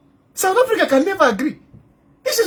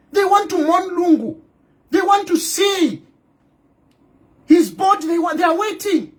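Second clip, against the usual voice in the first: heated shout; 4 semitones higher than usual. Real, not acted. Ranting at opposition lawmakers.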